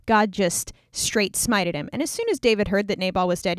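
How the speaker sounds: ordinary talk, nothing marked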